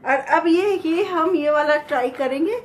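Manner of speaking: muffled behind surgical mask; speaking with mouth covered; through a face mask. Real, not acted. Seen 2: Blue surgical mask covers nose and mouth throughout labelled speech.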